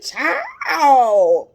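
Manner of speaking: loud, high, drawn-out exclamation; incredulous outburst. Real, not acted. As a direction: stretch it out in loud disbelief. Exclaims in disbelief at the idea.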